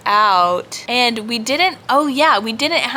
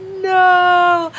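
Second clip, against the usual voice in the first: high exclaimed no; 11 semitones higher, 6 dB louder than usual. Retold or imitated. Both refusing separate locker rooms.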